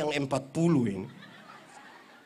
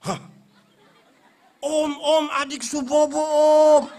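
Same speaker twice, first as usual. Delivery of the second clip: higher, louder, mock-frightened pleading; fake startled; 7 semitones higher, 11 dB louder, slower than usual. Mother pretending to be scared, pleading with the uncle outside, mock fright. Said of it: "sengaja kaget".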